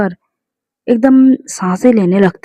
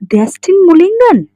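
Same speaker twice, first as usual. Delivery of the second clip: high rising disbelief; astonished; 5 semitones higher, 4 dB louder, slower than usual. A narrator's reading. Suzana learns who asked for ten girls, astonished. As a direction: question the name in surprise, leaping up in pitch. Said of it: "वो हैरान हो जाती है".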